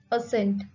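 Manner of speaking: ordinary delivery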